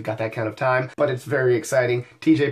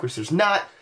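ordinary talk, nothing marked

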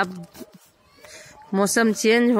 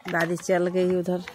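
ordinary talk

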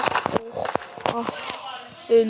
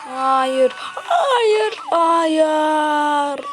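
screaming no; 4 semitones higher, much slower than usual. Acted. Falling, crying out.

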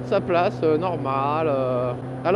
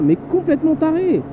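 mock crazy voice; 9 semitones higher than usual. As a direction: play the madman with a stretched "complètement". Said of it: "j'étais super bien parti en mode, complètement taré".

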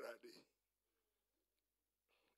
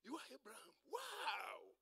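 high-pitched awed "wow"; amazed greeting; 8 semitones higher than usual. Acted. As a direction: act out a star-struck greeting. Acted amazed greeting to Abraham.

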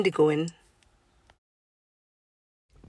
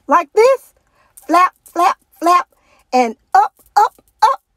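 much higher and louder, bouncy demo; coaching teacher; 10 semitones higher, 6 dB louder than usual. Acted. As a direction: cheer the birds into the air. Teacher shows flight practice.

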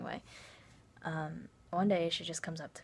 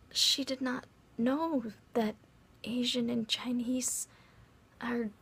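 slow, higher, emphatic incredulity; exasperated disbelief; 5 semitones higher, slower than usual. Retold or imitated. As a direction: spell out the unbelievable, word by word. Storyteller aghast at friend's ignorance.

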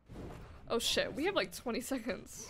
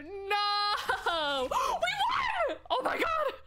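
excited shout; 8 semitones higher, much slower than usual. Real, not acted. Team wins the match.

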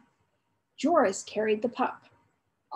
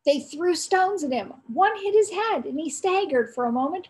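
plain reading voice

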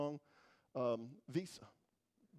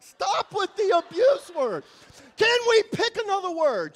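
exasperated plea; 9 semitones higher, 11 dB louder than usual. Retold or imitated. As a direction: beg her to drop that word. Exasperated at abuse accusation.

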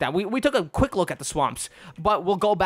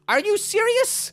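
sidekick voice; 14 semitones higher, slower than usual. Acted. Companion Bobbin answers back.

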